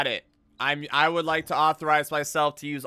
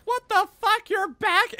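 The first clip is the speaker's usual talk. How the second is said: much higher mock outrage; imitating Samus. Streamer imitating Samus seeing Ridley return, outraged. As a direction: squeak it in disbelief. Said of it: "an emotion of Samus looking around going like".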